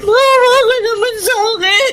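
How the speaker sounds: loud sobbing plea; wife crying out for pity; tearful pleading. Acted. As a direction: beg through tears.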